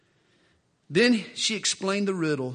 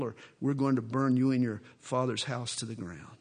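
low, menacing retold threat; 5 semitones lower than usual. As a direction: lean in and menace her. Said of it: "they threaten Samson's bride".

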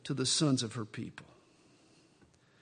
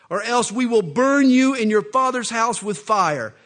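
shouted threat of fire; loud threat; 5 semitones higher, 10 dB louder than usual. Acted. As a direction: roar it at her. Philistines threaten to burn her.

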